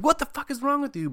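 very high imitated outburst; hammed-up indignation; mock outraged demand. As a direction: burst in, appalled and high-pitched. Voices counselor breaking up attack.

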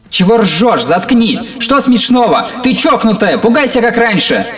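high, loud frantic screaming; furious yelling. Acted. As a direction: scream at her in panic and rage. Boy screaming at laughing sister.

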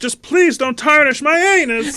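very high, slow begging; squeaky pleading voice. Pretend. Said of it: "He's in there like".

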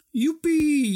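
high loud cheer. A narrator's reading. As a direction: whoop with glee. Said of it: "juicht ze".

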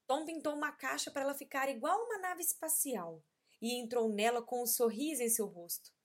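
ordinary delivery, nothing marked

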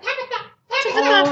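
high, loud mock calling of a name; TV character imitation. Friend imitates a soap character for her co-hosts, high loud mock cry. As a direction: call it out like a hammed-up soap character. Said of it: "Anyway, it was like".

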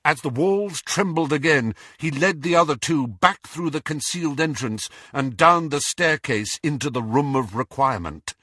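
ordinary delivery

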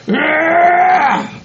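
long, high strained "aah"; straining groan. Acted. Man digging a hole in manure, straining groan.

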